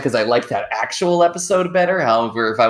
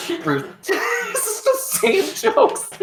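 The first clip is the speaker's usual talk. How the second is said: laughing; much slower than usual. Real, not acted. Laughing at repetitive poop jokes.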